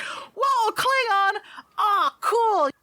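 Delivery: put-on high-pitched childlike delight; excited little fan; awestruck kid. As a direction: gush like an impressed kid. Game master acts awestruck kids.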